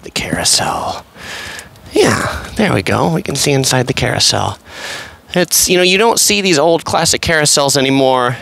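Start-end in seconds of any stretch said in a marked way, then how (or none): none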